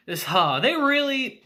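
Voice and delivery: ordinary talk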